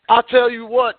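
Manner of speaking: normal talking voice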